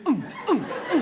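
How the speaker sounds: loud high retching grunts; mimicking a cat being sick.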